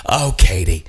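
loud imitation of a crazy old star; mock-grand, projected needling question; Cloris Leachman. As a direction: call it out loudly, hammed-up. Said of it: "then Cloris is like".

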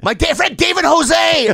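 high, slow, loud retold shout; heated yell. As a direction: re-enact yelling back in an argument. Retold shouting match, own retort.

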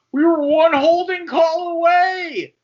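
mocking the team's management in a high, loud voice; mocking Jaguars management. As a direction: whine it like a sore team executive clinging to past glory. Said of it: "Jaguars management is clinging on".

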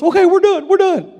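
put-on high, loud, eager kid voice; imitating relieved kid. Son quitting bull riding to his dad, high and eager. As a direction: blurt it out like a relieved boy. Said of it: "He goes".

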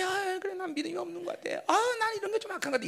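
mock half-hearted, softened confession; imitated lukewarm musing; vague admission. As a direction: toss it off noncommittally, as if unconvinced. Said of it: "막연하게".

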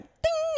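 very high, ringing ding; imitated ding. Storyteller mimics a sudden realization, high ding. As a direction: sing out a bell-like ding. Imitated elevator or alert chime.

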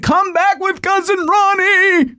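high, slow yell after a runaway horse; high slow mock yell, chasing the horse down the driveway; retold scream. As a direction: call out desperately after the horse. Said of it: "we're screaming at the horse".

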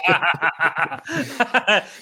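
long burst of amused laughter; real laughter. Real, not acted. Laughs at the chat's joke.

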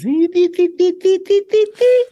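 very high, loud staccato squeals; mimicked squealing. Comedian prompting host about the film line, high loud squealing. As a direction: squeal a string of piercing syllables.

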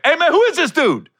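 high, loud, confrontational demand; going off. Retold or imitated. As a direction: confront the stranger hard. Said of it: "went left on him quick".

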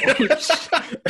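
high amused gasp of surprise; mock surprise. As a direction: react half laughing. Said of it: "I said".

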